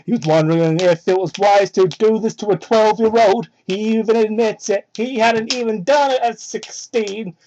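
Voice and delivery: ordinary delivery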